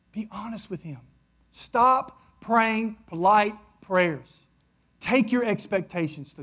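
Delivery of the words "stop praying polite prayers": normal talking voice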